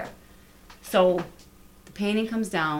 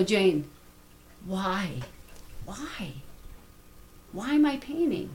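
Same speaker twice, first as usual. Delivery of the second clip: retold tearful plea; 3 semitones higher, slower than usual. Artist asking the buyer why her painting, mildly tearful retelling. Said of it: "I cried and I sobbed".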